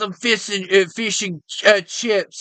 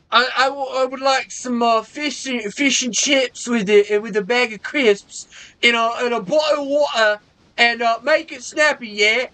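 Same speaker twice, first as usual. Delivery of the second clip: slightly higher, hammed-up British order; British customer snapping at a waiter; mock British accent; 4 semitones higher than usual. Mock British accent, ordering fish and chips. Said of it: "my version of a British man".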